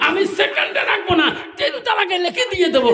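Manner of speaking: high-pitched declamatory outburst; acted shouted threat. Husband threatens his wife with divorce, high shout.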